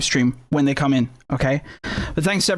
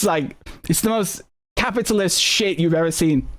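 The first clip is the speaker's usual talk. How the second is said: usual speaking voice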